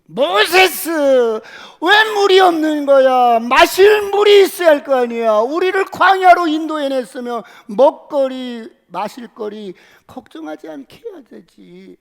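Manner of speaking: loud, high, furious complaint at Moses; angry crowd yelling. Acted. Thirsty crowd yelling at Moses, furious. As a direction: shout the grumbling demand for water.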